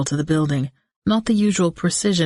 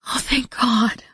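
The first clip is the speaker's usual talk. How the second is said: whispered relief into his chest; relieved mumble; 7 semitones higher, slower than usual. A narrator's reading. Charlie relieved as Drake holds her, whispering. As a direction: breathe out the relief, barely voiced. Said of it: "I mumbled into his flight suit".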